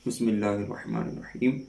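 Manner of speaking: slow, measured letter reading; تلاوت کے انداز میں حروف; recitation. Acted. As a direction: recite each letter in Arabic.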